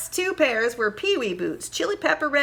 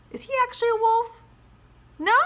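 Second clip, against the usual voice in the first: put-on higher, quick playful question to kids; curious question; 12 semitones higher, faster than usual. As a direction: check in with the listeners. Teacher asks class.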